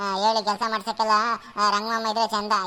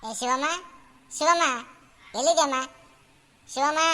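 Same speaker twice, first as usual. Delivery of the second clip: raised, higher calling voice; 5 semitones higher than usual. Acted. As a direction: call for someone out of sight. Calling for someone inside.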